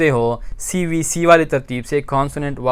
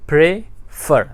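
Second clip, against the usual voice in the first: Prefer slowly split, weight on फर; prefer in two beats, the second stressed; stress on last syllable; much slower than usual. Shown as a demonstration. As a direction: pause after pray, then land on फर. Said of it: "अगर आखिरी syllable पे ज़ोर हो".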